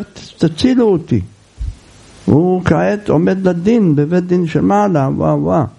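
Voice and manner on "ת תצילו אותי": ordinary talk, nothing marked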